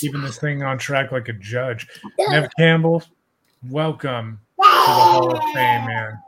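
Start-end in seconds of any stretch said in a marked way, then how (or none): 2.3-6.2 raised, loud proclamation; loud hall-of-fame induction call; celebratory announcement. Real, not acted. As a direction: announce the inductee to a cheering room.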